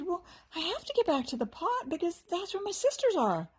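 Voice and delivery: high, lightly voiced fairy, a little worried. A narrator's reading. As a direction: play the small fairy anxious to get home. Fairy Fern's character voice.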